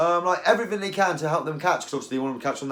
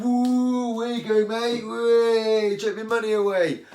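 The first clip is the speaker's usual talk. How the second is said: high, hammed-up whooping mockery of big spenders; mocking whoop; 9 semitones higher, 4 dB louder, slower than usual. Mocking sponsored anglers pouring pricey dip, high whooping mock cheer. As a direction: cheer them on sarcastically, pitched way up. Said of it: "Like, I don't say, like".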